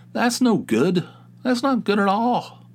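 hammed-up dismay; mock dismay. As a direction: wail about the losses. Reacting to losing money.

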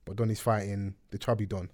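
ordinary talk, nothing marked

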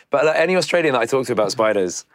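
ordinary talk, nothing marked

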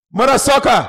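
put-on high, loud African accent comic shout; loud comic catchphrase. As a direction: bellow the catchphrase like on stage. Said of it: "I'm like".

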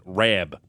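imitated "Rab" for Rob, O as A; mimicked Buffalo-area accent on "Rab"; Western New York vowel. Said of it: "I love that Western New York accent".

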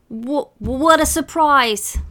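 high, loud, slow stammered greeting; stuttering surprise. A narrator's reading. Boy hiding panic from Dad, stuttering. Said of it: "Eric stuttered".

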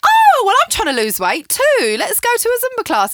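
very high, loud chirpy voice; over-eager friend. Retold or imitated. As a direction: play a clueless, gushing friend. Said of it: "and they're like".

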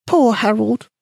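high, tiny mouse squeak; squeaky mouse voice. A narrator's reading. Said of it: "he squeaked quietly".

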